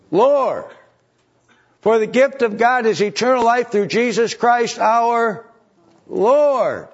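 high, loud, swelling sermon cry; preaching at full force. Acted. Preacher rallying his flock, rising to a loud high cry. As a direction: lift the congregation toward the victory.

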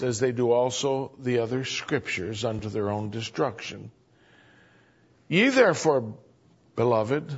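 measured reading from the pulpit; scripture reading. Acted. Preacher reads the epistle to the congregation, measured. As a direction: read the verse with weight.

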